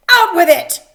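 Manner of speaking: loud barked order; angry demand. Acted. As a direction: snap at the stalling soldier. King demands news.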